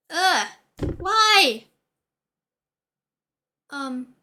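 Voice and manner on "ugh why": exasperated ugh, louder and higher; annoyed ugh. Real, not acted. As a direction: groan at an itchy nose. Itchy nose, annoyed.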